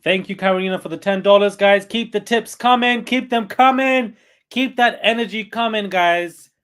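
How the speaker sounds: loud, high hype for donors; hyped thanks. Real, not acted. Thanking a donor and hyping tips, loud excited call. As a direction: pump up the chat.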